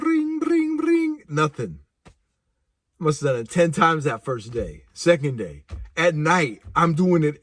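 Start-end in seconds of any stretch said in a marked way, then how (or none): 0.0-1.2 high imitated ringing; ringing phone sound. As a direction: mimic the phone ring. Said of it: "phone ringing".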